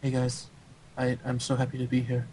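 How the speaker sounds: soft, halting accented player impression; Rhett. Podcast host imitating an absent teammate greeting everyone, softly accented. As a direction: imitate a polite foreign gamer, softly. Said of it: "wanna hear my Rhett impression".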